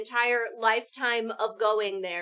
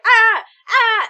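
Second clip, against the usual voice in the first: flustered cry; 10 semitones higher, 16 dB louder than usual. Pretend. Imagines awkward dinner reaction.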